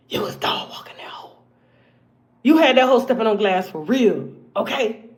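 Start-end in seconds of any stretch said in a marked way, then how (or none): 0.1-1.4 hushed whisper aside. Real, not acted. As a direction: drop to a secretive whisper. Gossipy aside to Millie.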